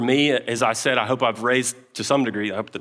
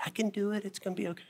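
high, soft voice holding it together; high, hushed, cracking reassurance on the phone; strained brave face; 9 semitones higher, 12 dB quieter, much faster than usual. Retold or imitated. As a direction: sound like you're about to crack. Calling wife mid-meltdown, barely coping.

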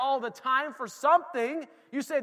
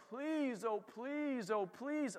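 begging; 9 dB quieter, much slower than usual. Pretend. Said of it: "if you're just begging".

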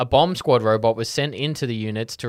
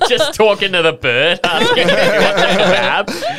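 laughing; 8 semitones higher, 7 dB louder than usual. Real, not acted. Laughing mock of Merrick.

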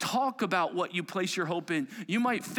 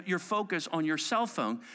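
usual speaking voice

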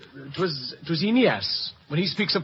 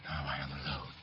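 hushed; 18 dB quieter, faster than usual. Acted. Hamlet's soliloquy begins.

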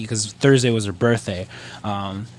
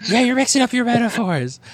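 high-pitched mock complaint; outraged fan; 12 semitones higher than usual. Fan correcting the teller's metaphors, high mock indignation.

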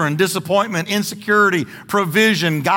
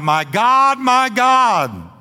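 raised, higher-pitched retold cry; 5 semitones higher, 3 dB louder, much slower than usual. Preacher voicing Jesus crying out on the cross, raised cry. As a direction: call it out with long, lifted vowels. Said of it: "he cried out".

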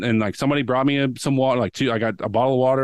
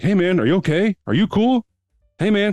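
concerned strangers; 9 semitones higher, 4 dB louder than usual. Retold or imitated. Bystanders checking on fallen friend.

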